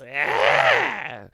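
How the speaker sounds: sudden high, loud "ah"; imitating a monster popping out; jump-scare yell. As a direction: burst out with a startling shriek. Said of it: "he just pops up out of the darkness".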